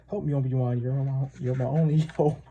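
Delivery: mock pleading, giggling at the end; Star Wars plea. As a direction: lightly mimic a famous film plea, then crack up. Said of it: "Sounds like a little bit like, uh, uh, Star Wars".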